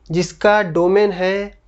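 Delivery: ordinary talk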